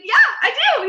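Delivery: high, loud, delighted agreement; eager yes. Retold or imitated. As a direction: jump at the offer. Eager answer about horses.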